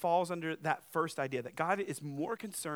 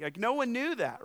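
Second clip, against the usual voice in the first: ordinary talk, nothing marked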